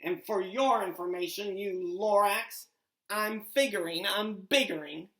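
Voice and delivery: the Onceler shouting down his critic; yelling Onceler. Acted. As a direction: yell back at the Lorax defiantly. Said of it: "I yelled at the Lorax".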